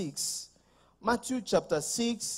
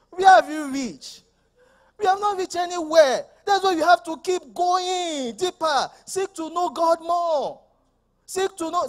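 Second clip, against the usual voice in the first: high, loud preaching voice; fiery preaching; 9 semitones higher, 6 dB louder than usual. Acted. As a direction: preach it with full force. Preaching at full force.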